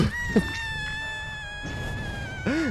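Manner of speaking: drawn-out squealing laugh; long high laugh. Real, not acted. Streamer cracking up at own bluff, squealing laugh.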